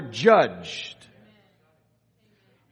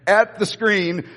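normal talking voice

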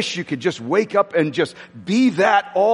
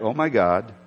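flat reading; 9 semitones lower than usual. Shown as a demonstration. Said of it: "we read that like".

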